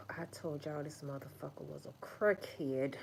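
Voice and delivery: low, soft bitter grumble. Retold or imitated. Ex sulking to the others about you. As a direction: grumble it like a sore ex. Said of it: "your ex is like".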